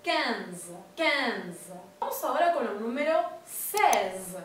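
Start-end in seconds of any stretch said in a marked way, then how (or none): 0.0-1.7 "quinze" with a nasal E. Shown as a demonstration. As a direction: push the E of "quinze" through the nose. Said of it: "es una E nasal".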